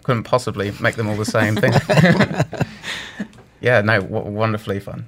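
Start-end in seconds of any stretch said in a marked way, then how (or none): 1.8-3.2 laugh. Real, not acted. Laughing about making voices.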